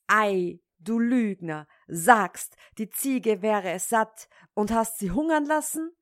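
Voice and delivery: lautes, wütendes Schimpfen; zorniger Vater. Acted. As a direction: schimpf den Sohn lauthals aus. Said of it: "in seinem Zorne". (Schneider beschimpft den lügenden Sohn, laut und zornig)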